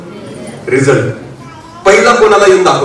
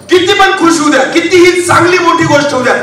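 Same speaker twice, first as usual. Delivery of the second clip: high-pitched, insistent sermon delivery; pitched-up preacher's proclamation; raised preaching; 11 semitones higher than usual. Acted.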